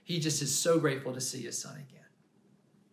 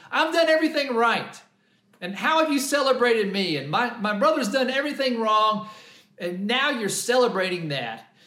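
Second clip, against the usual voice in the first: higher, louder, aggrieved complaint; mad older brother; 6 semitones higher, 6 dB louder than usual. Retold or imitated. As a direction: rail at the father like the resentful son. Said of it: "He's just so mad".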